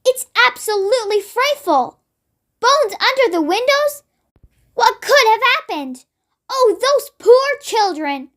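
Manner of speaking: appalled but composed queen; lightly voiced queen, horrified.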